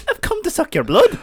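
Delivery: high, loud hammed-up Irish vampire; Irish Dracula. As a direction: ham up Dracula with an Irish accent. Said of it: "Dracula with his Irish accent".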